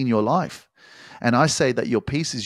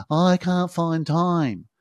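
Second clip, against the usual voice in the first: much higher and very slow, drawn-out whine; whiny mock excuse; 9 semitones higher, much slower than usual. Coach mocking a viewer who says they can't find time. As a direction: drag it out like a pitiful excuse.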